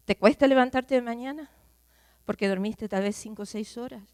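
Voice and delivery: normal talking voice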